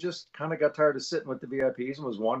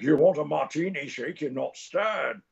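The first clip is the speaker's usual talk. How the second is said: Sean Connery; 4 semitones higher than usual. Retold or imitated. Fan reciting the martini line at Sean Connery, hammed-up impression. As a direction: stretch it out like a bad star impression. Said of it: "trying to do your voice".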